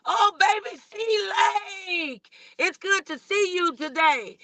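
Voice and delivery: ordinary talk, nothing marked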